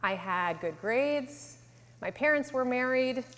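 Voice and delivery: usual speaking voice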